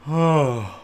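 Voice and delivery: big audible yawn. Real, not acted. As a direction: let out a long yawn. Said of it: "Pardon my yawn".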